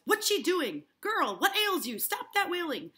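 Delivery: loud, high, agitated demand; angry scolding. Acted. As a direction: scold at full volume. Proctor scolds girl, acted.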